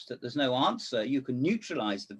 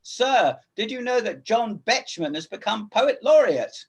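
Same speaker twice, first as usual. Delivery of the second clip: raised voice; 8 semitones higher, 6 dB louder than usual. Retold or imitated. Retelling his words to the teacher.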